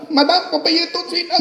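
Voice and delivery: high, insistent little cashier; cashier's demand. Retold or imitated. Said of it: "la petite dame".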